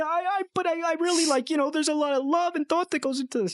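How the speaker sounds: very high, fast, stammering squeak; mock-whiny defense; flustered kid. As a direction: babble defensively like a hurt kid. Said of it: "And I said".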